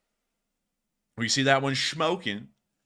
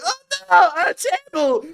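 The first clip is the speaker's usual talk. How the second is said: much higher and louder squeal imitating the soldier; mock scared soldier; 8 dB louder than usual. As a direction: ham up the panicked Russian. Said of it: "He's like".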